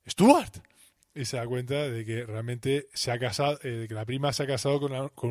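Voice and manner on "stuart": very high, loud shocked shout; very high, loud shocked cry. Retold or imitated. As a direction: shout the name in disbelief. Shocked recognition of the groom.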